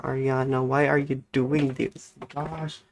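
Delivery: ordinary delivery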